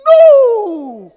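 long falling cry of no; emphatic refusal. Shown as a demonstration. Preacher rejecting meek prayer, drawn-out loud no. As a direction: cry it out and let it slide down.

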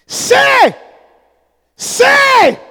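high, drawn-out shout; commanding call. Real, not acted. Preacher prompting congregation to speak up, shouted high.

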